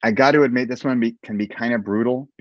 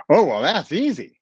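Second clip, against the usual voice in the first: higher, eager imitation of her; animated nutritionist; 8 semitones higher, 3 dB louder than usual. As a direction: play her quick confidence, pitched up. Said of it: "seeing her so animated".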